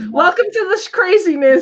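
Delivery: high laughing welcome. Real, not acted. Seller welcoming chat, laughing. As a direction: giggle the welcome.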